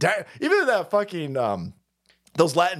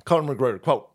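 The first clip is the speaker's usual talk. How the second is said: ordinary talk, nothing marked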